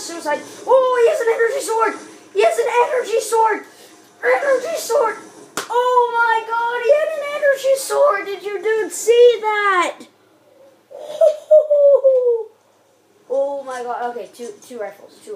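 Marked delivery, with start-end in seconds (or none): 0.7-10.1 high, loud frantic exclaiming; excited shouting. Real, not acted. Shock at energy sword kill. 10.9-12.5 high, loud whooping laughter; loud excited laugh. Real, not acted. After the sword kill.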